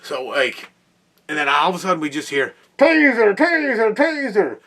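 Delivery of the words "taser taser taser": shouted much higher and louder, like a cop; yelled taser warning. Retold or imitated. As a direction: bark it out three times, urgent. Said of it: "I've never heard this yelled before".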